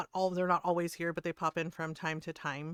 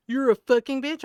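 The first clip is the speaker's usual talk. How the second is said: higher, louder mock outrage; imitated angry insult; 6 semitones higher, 10 dB louder than usual. Streamer retelling a man insulting her, loud mock outrage. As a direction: imitate a man losing his temper.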